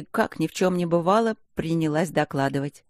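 ordinary delivery, nothing marked

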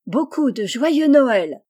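plus fort et plus aigu, « Noël » lent et étiré; crié en chœur. A narrator's reading. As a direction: crier joyeusement « joyeux Noël » ensemble, lentement. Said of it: "crièrent-elles en chœur". (les filles souhaitent joyeux Noël à leur mère en criant)

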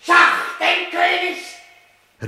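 very high, loud and drawn-out call of check; frenzied call. Acted. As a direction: cry it out as if through a red fog. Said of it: "meine eigene Stimme, wie sie ... ruft".

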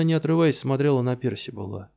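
ordinary delivery

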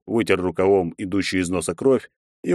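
ordinary delivery, nothing marked

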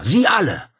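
high, loud accusing cry; angry scream. A narrator's reading. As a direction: fling it out at all of them. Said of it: "schrie Claudia".

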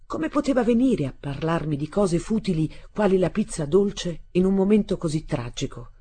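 ordinary delivery, nothing marked

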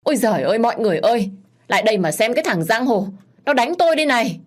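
high, loud hue and cry; hammed-up victim's cry, climbing in pitch; loud wailing outcry. A narrator's reading. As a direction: yell for the neighbours to come. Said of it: "mà la lớn".

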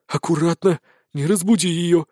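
mildly cautious warning; wary. A narrator's reading. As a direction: caution a companion, understated. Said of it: "настороженно произнёс Спирт". (слегка настороженно)

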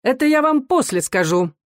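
high-pitched, spitefully polite; malicious politeness. A narrator's reading. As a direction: answer with venom under the courtesy. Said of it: "со злой вежливостью ответил офицер".

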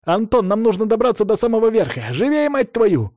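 high, urgent yell; urgent shout. A narrator's reading. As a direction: drive him on, shouting. Arthur urging Anton up tower.

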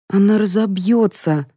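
ordinary delivery, nothing marked

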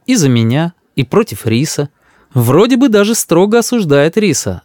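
ordinary delivery

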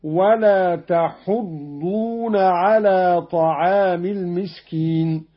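تحضون recited "tahuddun" with a short u on the ha; damma on ha, no alif. Shown as a demonstration. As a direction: recite تحضون slowly with no long a. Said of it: "بضم الحاء وحذف الألف هكذا".

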